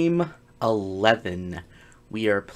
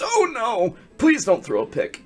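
high, loud yelp of alarm; panicked plea; 12 semitones higher, 5 dB louder than usual. Real, not acted. Player fearing a thrown pick, panicked plea. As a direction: beg in a raised, alarmed voice. Fears interception.

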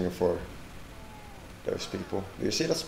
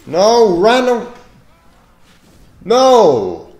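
loud, high outraged yell; angry shout; 14 semitones higher, 18 dB louder, much slower than usual. Real, not acted. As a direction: yell in protest. Dying to random crit.

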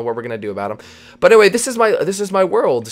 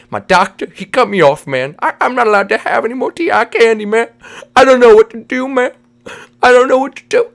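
higher, pleading lament with slushy s; distressed cartoon buddy; 9 semitones higher, 7 dB louder than usual. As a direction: wail mock-desperately about a craving. Vinny laments losing his candy.

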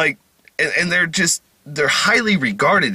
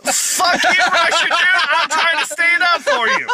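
mock angry rant; 10 semitones higher than usual. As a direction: yell back at the troll in a playful fake rage. Mock rant at Russian commenter.

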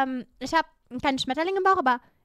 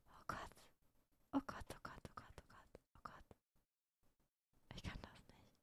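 tiny, voiceless panicked muttering; nervous whisper; 27 dB quieter than usual. Real, not acted. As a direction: whisper repeatedly in dread, barely audible. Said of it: "ich bin so nervös". (panisches, kaum hörbares Flüstern)

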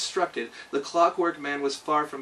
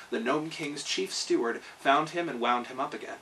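ordinary delivery

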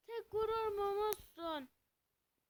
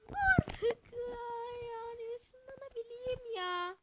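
whine; 10 semitones higher, slower than usual. Real, not acted. Frustrated gamer whining.

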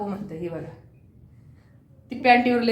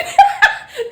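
a bright squealing laugh; high laugh; 7 dB louder than usual. Real, not acted. Mother's laugh.

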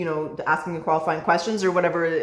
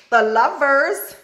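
high, louder, slow and stretched; excited reveal; 6 semitones higher, 5 dB louder, much slower than usual. Real, not acted. Reader reveals a hopeful love card, delighted. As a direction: savour the card name with delight.

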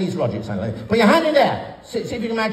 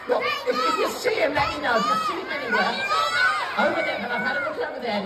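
high mock searching; 7 semitones higher, faster than usual. Panto searching for puppet.

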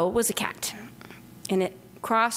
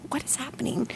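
higher, softer unvoiced whisper of disbelief; stunned whisper; 5 semitones higher, 6 dB quieter than usual. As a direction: whisper it in bewilderment. Man hears of mountain lion.

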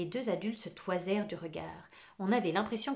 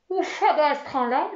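voix étouffée; 6 semitones higher, 10 dB louder than usual. Acted. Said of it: "dit-elle d'une voix étouffée".

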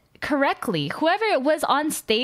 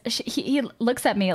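usual speaking voice

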